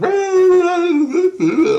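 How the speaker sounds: a sudden very high, loud wail; imitated ringing yell. Teller mimicking the yelling in his head, a high wail. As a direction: mimic the noise blaring inside your head. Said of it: "like that right in my head".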